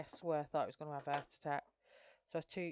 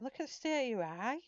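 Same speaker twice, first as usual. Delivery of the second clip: much higher, insistent; higher-pitched mock scolding; retold alarmed telling-off; 8 semitones higher, 4 dB louder than usual. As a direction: urge him to look, half laughing, half alarmed. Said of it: "I went".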